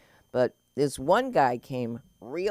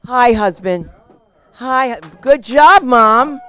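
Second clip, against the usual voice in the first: loud, cheery shout across the room; calling out; 9 semitones higher, 15 dB louder, slower than usual. Real, not acted. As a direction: call out to the family in the crowd. Calling out to family in crowd.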